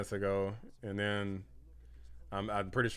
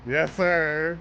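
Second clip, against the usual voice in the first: higher, louder; hype shout; 7 semitones higher, 4 dB louder, much slower than usual. Real, not acted. Streamer cheering the winner for chat, triumphant shout. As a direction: bellow it in triumph. Celebrating the victory speech.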